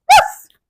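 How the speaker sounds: high, loud playful woof; cheerful puppy bark. Acted. Narrator voices puppy waking hopeful, cheerful bark. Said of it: "letting out a cheerful bark".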